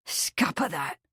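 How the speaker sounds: low, soft, furious footman. A narrator's reading. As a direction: seethe it under your breath. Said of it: "in a low, furious voice".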